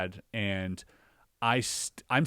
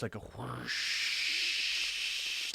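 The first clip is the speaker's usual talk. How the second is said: whoosh noise; 6 dB quieter, much slower than usual. Retold or imitated. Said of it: "hearing a loud whoosh".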